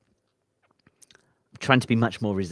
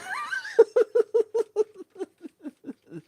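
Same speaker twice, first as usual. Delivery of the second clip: big laughing shout. Real, not acted. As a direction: let out a sudden whoop of laughter. Can't find page twenty.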